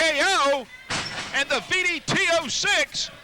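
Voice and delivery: high, loud pinfall call; excited play-by-play. Real, not acted. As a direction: build to the winning pin.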